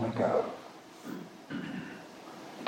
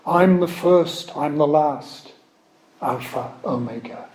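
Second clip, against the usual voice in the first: slow, raised, solemn proclaiming; slow solemn scripture reading; 3 semitones higher than usual. Acted. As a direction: read it slowly like a prophet calling Jacob.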